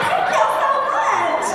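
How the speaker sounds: very high, slow, sweet thank-you; gushing thanks. Retold or imitated. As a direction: overdo the flattered reply, lilting high. Her reply to the fan.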